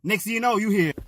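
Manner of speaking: usual speaking voice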